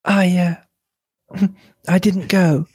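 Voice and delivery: slightly high, hesitant denial; falter, then deny it; abrupt, halting. A narrator's reading. Mrs Cavendish answers Hastings, haltingly abrupt. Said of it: "she replied abruptly".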